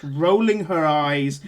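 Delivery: ordinary talk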